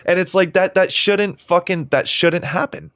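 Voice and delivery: getting teary while insisting; real emotion breaking through, slowed; choked up. Real, not acted. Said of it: "my eyes are welling up".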